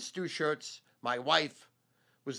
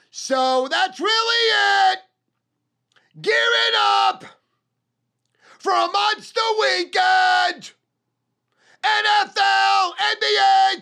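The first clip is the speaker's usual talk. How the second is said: far higher and louder, slow drawn-out roar; hype shout; 14 dB louder, slower than usual. Real, not acted. Hyping his weekend betting picks to viewers, slow drawn-out roar. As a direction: bellow it like a ring announcer. Hyped promo shout of sports slate.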